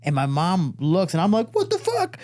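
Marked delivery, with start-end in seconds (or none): 1.5-2.2 very high, panicked squeal; retold shock, pitch sky-high; freaking out. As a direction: reenact a kid freaking out. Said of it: "I'm freaking out".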